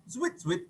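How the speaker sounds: "zwit, zwit" said high and soft; a light, high lace-tying noise; playful soft zwit; high soft sound effect. Acted. Sound effect while reading aloud.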